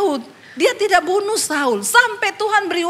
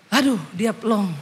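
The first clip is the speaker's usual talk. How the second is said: usual speaking voice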